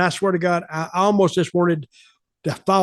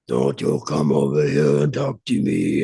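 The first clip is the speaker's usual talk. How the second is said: gruff, much lower mock warning; angry retold quote. Wrestler snapping at a ten-year-old, low and gruff. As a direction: growl it down at a child. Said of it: "he got mad at her".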